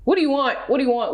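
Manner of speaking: normal talking voice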